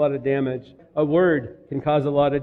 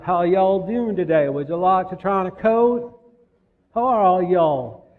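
put-on Southern accent; 3 semitones higher than usual. Yankee student greeting Tennessee coat shoppers in a put-on Southern accent. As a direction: imitate a Southern salesman's accent, badly. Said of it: "I can't do it as well as I did".

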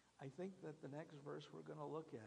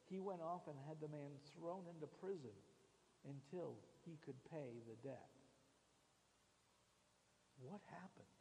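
normal talking voice

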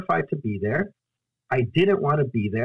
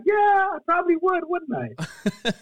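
laughing; slower than usual. Retold or imitated. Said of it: "And I was like".

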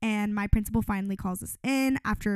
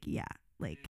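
much lower, hushed grudging reply; retold disdain; 14 semitones lower, 11 dB quieter than usual. Advisor seeing the finesse award with a dirty look, retold disdain. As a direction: drop it low and soft, unimpressed. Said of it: "gave me the dirtiest look".

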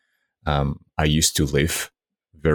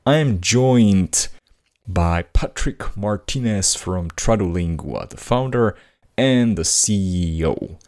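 upbeat host introducing the episode; podcast intro; 4 semitones higher than usual. Acted. Host opening the episode, bright announcer read. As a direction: announce the guest like a show opener.

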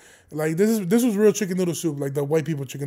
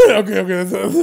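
explosive laugh, then words through chuckles; helpless laughter; 2 semitones higher, 9 dB louder than usual. Real, not acted. Host failing a moment of silence, laughing. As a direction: try to compose yourself while laughing. Failing to keep straight face.

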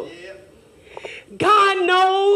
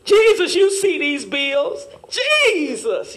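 mock desperate prayer; slower than usual. Preacher mocking the congregation's bill prayer, pleading. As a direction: plead slowly, like the bills are piling up. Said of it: "we get to crying Jesus real hard".

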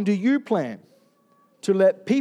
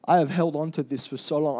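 tearful apology; 5 semitones lower, 5 dB quieter than usual. Retold or imitated. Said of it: "I was weeping".

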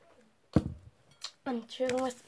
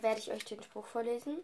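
normal talking voice

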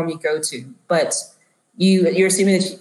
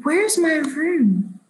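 put-on very slow, high old voice; confused elderly woman; 8 semitones higher, much slower than usual. Elderly woman asking a stranger for her room, slow and high. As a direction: stretch the question out high and lost. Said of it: "And she looks at Yusuf".

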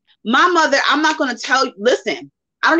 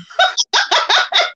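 light, high laugh. Real, not acted. Host giggles high when cake talk starts.

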